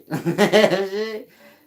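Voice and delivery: high, loud burst of laughter; cackling at his own joke; loud cackle. Real, not acted. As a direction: crack up laughing hard.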